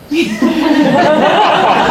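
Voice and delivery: sudden high laugh; high burst of laughter. Real, not acted. Guest laughing recalling being asked if married. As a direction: laugh at the question.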